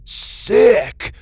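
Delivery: very high, loud yelp; excited shout. A narrator's reading. Star trading shouts with his robot, high excited yell. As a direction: shout it out, thrilled. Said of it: "exchanged excited shouts".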